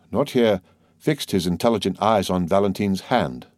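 ordinary delivery, nothing marked

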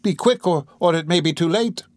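urgent. Acted. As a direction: hurry the dying woman along. Matron hurries the dying woman.

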